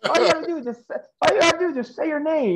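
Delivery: high-pitched, hurried imitation of a coach; mock exasperation. Coach telling yelling trainees to keep it simple, mock exasperated.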